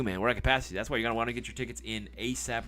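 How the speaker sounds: ordinary talk, nothing marked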